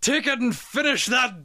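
put-on generic Scottish accent building to a high, loud, slow last command; a hammed-up Scottish accent swelling into a rallying cry; dying Scottish warrior. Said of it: "it's my generic Scottish voice".

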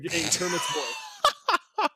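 high, slow, creepy coaxing voice; creepy predator voice. Retold or imitated. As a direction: lure him in, sickly sweet. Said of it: "gonna whisper sweet nothings in the ear".

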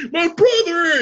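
loud, high cry of "My brother!"; excited shout. Retold or imitated. Farley shouting at his brother in joy. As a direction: belt it out like a hug reunion. Quoting Tommy Boy hug scene.

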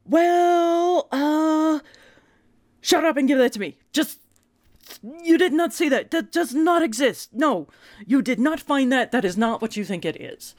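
high-pitched, flustered stammering denial; flustered panic. Retold or imitated. Mom caught out by her son, flustered panic. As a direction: scramble to shut the question down. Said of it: "I'm like".

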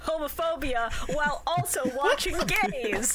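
hammed-up show opener, slowing at the end; mock podcast intro. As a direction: announce the show like a host.

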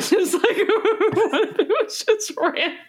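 laughing while talking; words squeezed out through giggles. Real, not acted.

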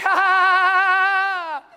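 loud, high hammed-up cackle; loud mock laughter. Someone laying on the church floor laughing out loud, hammed-up. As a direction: burst into big fake holy laughter. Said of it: "they'd start laughing".

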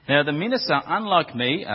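ordinary talk

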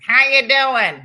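loud, brash holler; mock American greeting. American mocking how Americans sound to foreigners, loud and brash. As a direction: belt it out as a hammed-up hello.

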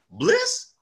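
Bliss? jumps way up in pitch, a happy rising question; high-pitched surprised Bliss?; surprised recognition. Retold or imitated. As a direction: recognize the name with delight. Clyde recognizing his name.

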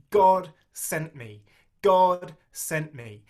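slow down and press each word of "God sent me"; emphatic repetition. Shown as a demonstration. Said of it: "Look at how Joseph has this emphasis".

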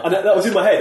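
high, loud, through a laugh; self-amused laughing. Real, not acted. Laughs at own old ambition.

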